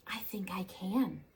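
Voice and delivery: soft whisper, just to herself; little engine whispering, unsure; whispered resolve. A narrator's reading. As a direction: breathe it like a quiet hope. Engine's first quiet try.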